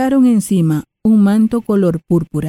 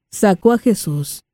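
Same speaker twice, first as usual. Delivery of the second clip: ordinary talk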